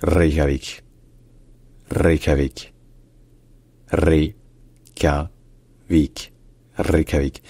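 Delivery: "Reykjavik" avec r roulé et k palatal; prononciation islandaise. Shown as a demonstration. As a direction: rouler le r de "Reykjavik", bien découper. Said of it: "à l'origine, en islandais, ça se dit". (« Reykjavik » à l'islandaise, r roulé)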